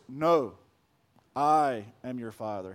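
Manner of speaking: very low, soft Vader voice; deep and slow, the real line; Darth Vader. Retold or imitated. Preacher giving Vader's actual line, deep and low. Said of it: "Darth Vader just says".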